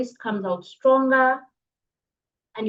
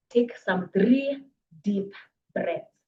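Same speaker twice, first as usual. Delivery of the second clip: slow, calm guiding voice; much slower than usual. Shown as a demonstration. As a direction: ease them into breathing slowly. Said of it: "leads breathing exercise".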